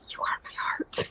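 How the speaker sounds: breathy little whisper, no voice at all; child's whisper. Retold or imitated. Teacher quoting a toddler's whisper, soft whisper. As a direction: whisper it like a tiny girl sharing a secret. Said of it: "this little child be like".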